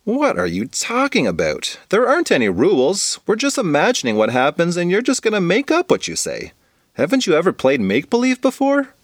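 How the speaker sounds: weirded-out, high-pitched questioning; baffled disbelief. Acted. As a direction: explain the obvious in astonishment. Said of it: "Bridget's face turned weird".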